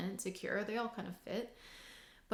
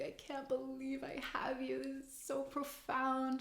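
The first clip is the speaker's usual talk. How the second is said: high whispered, mock-overwhelmed gush; hushed, hammed-up wonder at a lover; breathy mock awe; 6 semitones higher, 3 dB quieter than usual. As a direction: whisper it, mocking the lovestruck. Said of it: "I'm not mocking".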